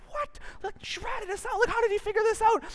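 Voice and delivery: high, squeaky panicked disbelief; shocked disbelief. Retold or imitated. Caught students squeaking out their shock, high and panicked. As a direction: yelp it out like a kid just caught.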